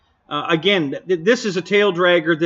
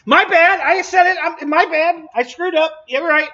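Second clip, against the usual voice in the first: much higher, laughing through the apology; 11 semitones higher, 3 dB louder than usual. Real, not acted. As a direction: giggle out your own mistake, high and bright with laughter. Admits he kept saying Avanti.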